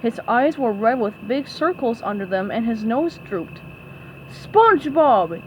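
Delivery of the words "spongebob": yelled. A narrator's reading. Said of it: "yelled Mister Krabs".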